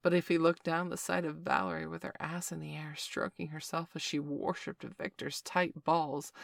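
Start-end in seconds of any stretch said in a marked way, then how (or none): none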